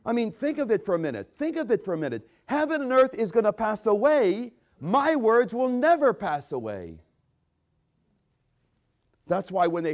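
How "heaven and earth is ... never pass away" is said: loud, high declaration, slowing on the second half; emphatic proclamation. Acted. Preacher quoting Jesus' claim to the congregation, loud and emphatic. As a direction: proclaim it at full preaching force.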